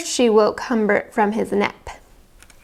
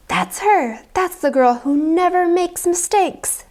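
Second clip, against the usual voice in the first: excited woman; 8 semitones higher than usual. A narrator's reading. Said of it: "said a woman".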